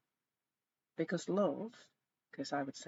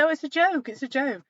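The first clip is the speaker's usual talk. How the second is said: higher, louder, dismissive mimicry; mock husband excuse; 9 semitones higher, 12 dB louder than usual. Wife mimicking her husband's just-a-joke excuse, higher and louder. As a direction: brush it off like he does, pitched up. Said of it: "and then say".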